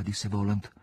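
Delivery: plain reading voice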